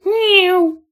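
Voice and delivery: high eerie meow in chorus; creepy kitten meow. Acted. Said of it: "all three said".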